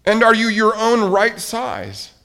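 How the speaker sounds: high, loud, eager question; wondering question. A narrator's reading. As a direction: ask high and eagerly, as Toad. Toad anxiously checks on Frog.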